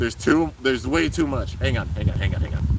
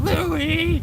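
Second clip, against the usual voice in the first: much higher mock yell of "Louie!"; imitating a yell. Voicing Drum hunting for Louie, high mock yell. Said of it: "Drum's running and yelling".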